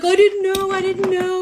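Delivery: high, pleading mock sob; mock whiny crier. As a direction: whine it like a crying boyfriend. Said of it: "he's like".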